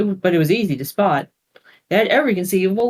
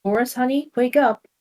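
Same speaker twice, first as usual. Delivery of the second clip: mother's voice; 7 semitones higher than usual. Acted. Mother waking son at night.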